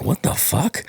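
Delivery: startled and baffled; confused surprise. Retold or imitated. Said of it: "And I'm like".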